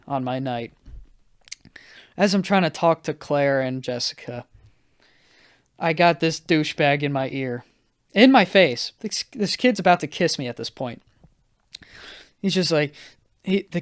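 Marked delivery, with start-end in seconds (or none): none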